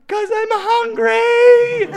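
very high, loud, slow wail; mock little-kid sobbing howl; crying child. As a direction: bawl it like a hungry toddler. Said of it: "you're really crying".

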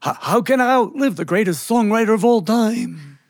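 high New York Yiddish lament; incredulous New York Yiddish old uncle; New York Yiddish accent. Acted. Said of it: "that New York Yiddish accent".

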